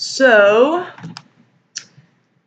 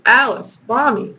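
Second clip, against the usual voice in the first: ordinary talk, nothing marked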